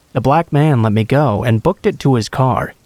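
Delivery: plain reading voice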